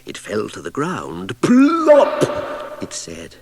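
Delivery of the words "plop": high shouted plop; booming sound effect plop; loudest plop. A narrator's reading. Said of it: "even louder than both the other plops".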